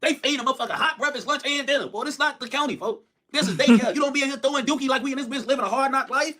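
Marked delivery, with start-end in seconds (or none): none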